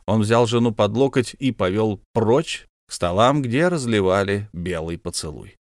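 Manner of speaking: plain reading voice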